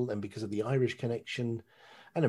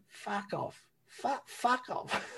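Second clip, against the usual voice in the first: high, strained mock doorman shooing crowd; high mock doorman telling the crowd to go away; shouty doorman; 12 semitones higher, 4 dB quieter, much slower than usual. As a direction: imitate the doorman yelling them away, high and drawn out. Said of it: "our doorman is going".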